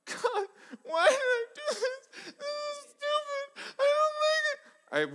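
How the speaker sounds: high, slow, sobbing whine re-enacted; retold crying. Pastor re-enacts crying on his bike, high sobbing wail. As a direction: wail it like a pastor reliving the ride. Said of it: "and I am crying. Literally crying".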